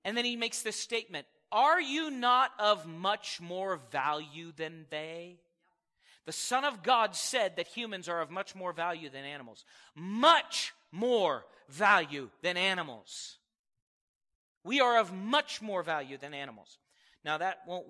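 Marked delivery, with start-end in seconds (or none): 1.5-5.4 slow, weighty, every word leaned on; slow emphatic quote. Shown as a demonstration. Preacher to congregation, slow emphatic scripture. 10.0-13.4 louder and slow, much hit hard; emphatic repetition. Real, not acted. Preacher drives point to congregation, loud slow emphasis.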